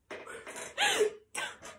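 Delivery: breathy giggle at a friend; kind gentle laugh. Real, not acted. Laughs at Polish heritage.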